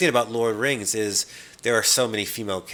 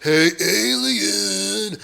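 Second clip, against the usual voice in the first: high, very slow, louder drawn-out taunt; mock action hero call; 7 semitones higher, 6 dB louder, much slower than usual. Mocking John McClane calling out the alien, slow and high. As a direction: stretch out a silly hero's call. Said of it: "running around the corridor saying".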